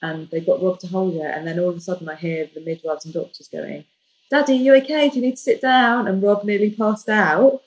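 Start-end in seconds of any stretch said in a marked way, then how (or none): 4.3-6.1 higher, louder caring nurse; concerned midwife voice. Retold or imitated. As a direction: check on the pale dad kindly. Midwives voiced asking Rob.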